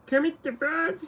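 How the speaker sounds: high, thin frog-puppet voice; Kermit voice. Shown as a demonstration. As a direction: try out Kermit the Frog's voice. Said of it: "Sounds like Kermit?".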